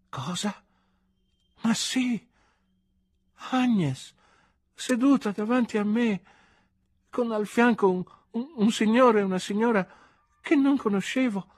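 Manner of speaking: put-on high-pitched drunk wonder, in halting bursts; drunken astonishment. A narrator's reading. Drunk narrator spotting his friend in the box, amazed.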